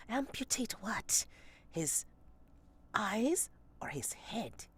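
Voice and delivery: hushed whisper pulled aside. Acted. Player pulls Sepsis aside, whispering. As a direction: whisper it so the others can't hear. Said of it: "pulls you aside a little bit, and goes".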